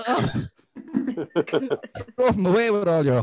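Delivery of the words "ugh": usual speaking voice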